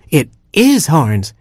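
a slow, eager little bird; dawning robin hero. Acted. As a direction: draw out the realization in the robin voice.

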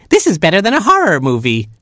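high, fast thrilled chick; Baby Chick. A narrator's reading. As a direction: gush it quickly in a chick voice. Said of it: "said Baby Chick".

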